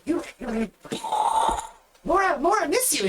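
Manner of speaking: high, affectionate goblin. Acted. Goblin girl hugging her captain, high and fond. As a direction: hug them with a squeal of joy.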